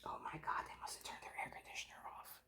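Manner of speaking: hushed whisper. Real, not acted. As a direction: whisper so no one hears. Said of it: "I don't hear it".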